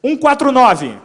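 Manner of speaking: loud, high number call; announcer call. Real, not acted. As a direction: call it out to the hall.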